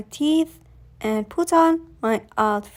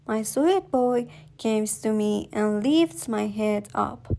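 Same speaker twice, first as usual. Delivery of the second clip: plain reading voice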